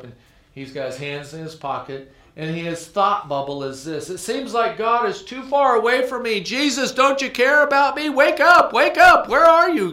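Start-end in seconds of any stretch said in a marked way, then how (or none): 4.1-9.9 high, loud pleading cry of a lost man; desperate cry to God. Acted. Preacher voicing cartoon man begging Jesus, high desperate shouts.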